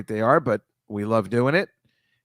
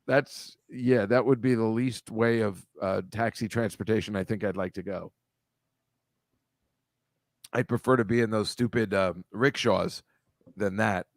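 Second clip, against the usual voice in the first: ordinary talk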